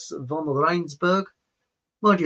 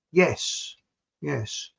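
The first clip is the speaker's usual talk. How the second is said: ordinary talk, nothing marked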